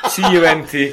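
spelled out through real laughter; letters squeezed out between laughs; laughing spelling. Real, not acted. Guest retelling Matt Damon's insult, laughing.

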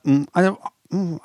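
normal talking voice

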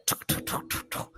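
voiceless running-footstep clicks; imitated footsteps. Storyteller imitates the girl running up behind them, voiceless footsteps. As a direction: tap out quick footsteps with the mouth, no voice. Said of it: "footsteps, like".

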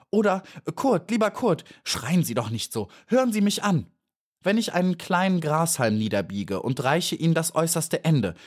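high, urgent, pleading bee voice; eager little bee. A narrator's reading. Maja urges the panicking beetle to listen, high and eager. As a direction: talk him down while you rush to help.

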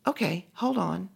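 high-pitched, polite phone voice; young woman answering. Retold or imitated. Young woman puts caller on hold, high and light. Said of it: "A young sounding woman answered".

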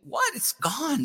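sudden high shocked yelp; shocked what. Real, not acted. Finding the article deleted, high startled shock. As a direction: react as if it vanished.

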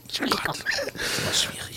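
whispered through suppressed giggles; stifled giggles. Real, not acted. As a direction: try not to burst out laughing. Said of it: "nicht lachen".

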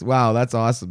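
normal talking voice

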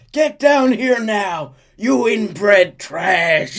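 loud high slow bellow; shouting. Acted. Dutch bellowing at the mansion for the boy. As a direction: call the enemy down from the mansion. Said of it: "Dutch shouts at the mansion".

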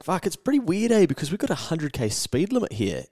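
high-pitched disbelief; astonished. Retold or imitated. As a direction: sound genuinely baffled. Retold astonished reaction.

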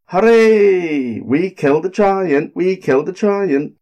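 high, loud cheer of triumph. A narrator's reading. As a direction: shout it out in victory. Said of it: "they said".

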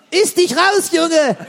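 high, carefree mum calling back; yelling across the room. Pretend. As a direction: shout the answer back, unbothered. Mother answering the child.

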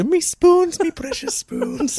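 high, hammed-up Irish wail; bad Irish accent. Guest imitates film heroine losing her spoons, mock Irish accent. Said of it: "She go".

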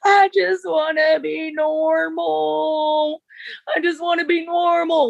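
high, slow, plaintive imitation of crying; tearful pleading. Friend imitating Britney sobbing in the van, high and slow. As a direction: stretch it out high like a girl in tears. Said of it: "she's just crying and sobbing".

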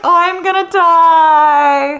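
high, loud, very drawn-out squeal; starstruck squeal. Retold or imitated. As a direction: wail it like you might faint. Starstruck panic.

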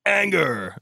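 high, hammed-up dramatic outburst; mock rant. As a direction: rant it like an overacting TV captain. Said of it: "as a rant".

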